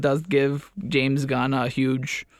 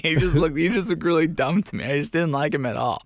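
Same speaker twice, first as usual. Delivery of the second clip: ordinary talk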